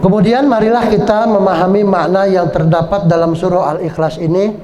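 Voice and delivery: usual speaking voice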